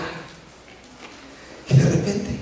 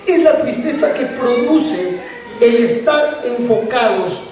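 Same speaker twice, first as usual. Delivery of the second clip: ordinary talk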